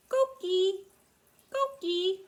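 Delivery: high, loud two-note chirp; coqui frog call. Shown as a demonstration. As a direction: imitate a coqui frog, holding the high second syllable. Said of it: "And the Coqui frog says".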